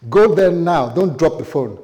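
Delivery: put-on loud, commanding kid's voice; mock demand, loud and insistent; bossy child's order. Child ordering dad to kiss wife.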